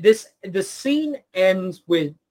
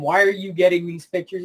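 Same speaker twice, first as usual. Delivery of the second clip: ordinary delivery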